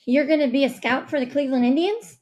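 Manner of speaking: eager guess. A narrator's reading. Rishi interrupting mom with a second guess, excitedly. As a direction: jump in with another guess.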